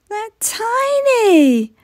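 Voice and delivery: high, slow drawn-out complaint; protesting. Acted. Ellie protests to Mum about cupcakes, high and slow. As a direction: whine it like a sulky child. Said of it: "Ellie protested".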